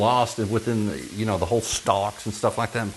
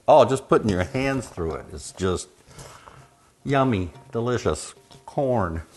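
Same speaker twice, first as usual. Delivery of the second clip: normal talking voice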